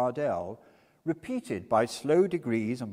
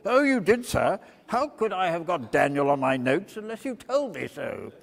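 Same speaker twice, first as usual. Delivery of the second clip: louder irritated rebuke; testy little judge; 6 semitones higher, 8 dB louder than usual. A narrator's reading. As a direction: scold the witness. Judge snaps at witness.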